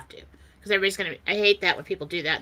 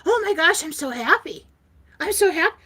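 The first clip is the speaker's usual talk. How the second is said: high-pitched, louder delight; overjoyed gushing; 9 semitones higher, 4 dB louder than usual. Retold or imitated. She reacts to long-awaited good news, overjoyed. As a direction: gush with a soaring voice. Acts her joy at the news.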